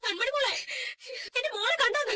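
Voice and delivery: high, panicked calling; frantic searching. Acted. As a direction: call out desperately for a lost child. Mother searching for missing child.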